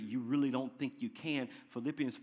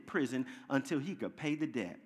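ordinary talk

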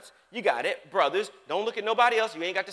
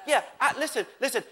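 ordinary delivery

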